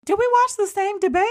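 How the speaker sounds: high, disbelieving squeal; very high incredulous squeak. Acted. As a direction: shriek it in disbelief. Incredulous reaction to voter.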